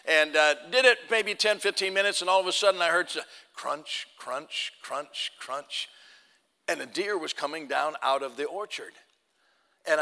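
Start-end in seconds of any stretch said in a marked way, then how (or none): 3.5-5.9 soft, low crunching sounds; imitated footsteps. Preacher imitating a deer walking in leaves, soft low crunches. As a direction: mimic hooves in dry leaves, quietly.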